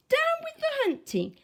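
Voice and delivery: high hooting cry; a mild high hoot. A narrator's reading. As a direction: hoot it out gently. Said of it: "For he hooted".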